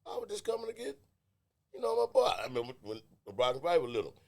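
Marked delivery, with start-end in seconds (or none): 0.1-2.3 high-pitched bewildered protest; comic, high-voiced pleading innocence; mock alarm. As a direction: act out a rattled dad caught off guard. Acted arrival at a war zone.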